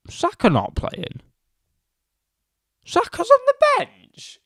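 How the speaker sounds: can't believe what you're reading; high-pitched shocked question; shocked disbelief. Real, not acted. Shocked at team news.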